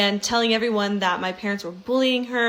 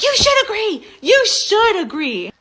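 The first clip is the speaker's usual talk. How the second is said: mock shrieked demand; 11 semitones higher, 7 dB louder than usual. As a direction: shriek it, then drag it out. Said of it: "she's screaming at me".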